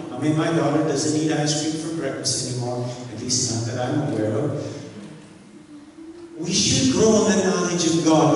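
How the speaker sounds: ordinary delivery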